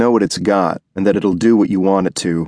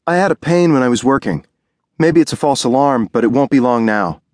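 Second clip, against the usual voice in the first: higher, gentle voice of a pregnant wife in pain; young wife's higher voice, hopeful and uneasy; Ruby's voice; 5 semitones higher than usual. Acted. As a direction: play the expectant wife, worried but brave.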